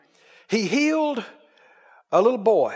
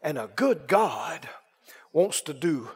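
dramatic preaching; 3 semitones higher, 4 dB louder, much slower than usual. Acted. Preacher reassuring the congregation, loud then hushed. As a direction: swell then whisper the promise.

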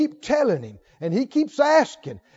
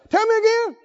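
eager repeat request; 9 semitones higher than usual. Retold or imitated. Acting David asking.

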